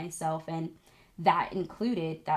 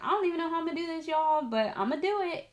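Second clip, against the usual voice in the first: fast, high, half-joking resolve; lightly acted gutsy pep talk to yourself; mock determination; 9 semitones higher, faster than usual. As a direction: rattle it off like you're psyching yourself up. Voicing a generic determined thought.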